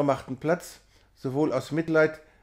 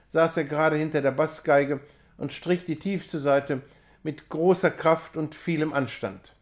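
ordinary delivery

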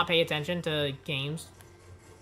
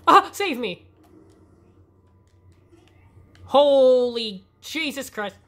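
panicked shout; 6 semitones higher, 8 dB louder, slower than usual. Real, not acted. Panicked in game.

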